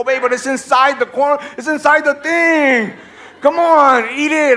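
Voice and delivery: high, insistent coaxing; exasperated pleading. Acted. Husband urging wife to eat cereal, exasperated pleading.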